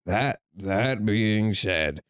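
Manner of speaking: put-on low, slow, tired old man voice; sleepy decrepit old man. Shown as a demonstration. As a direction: drag it out like a frail old man. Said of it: "do like a sleepy, decrepit old man".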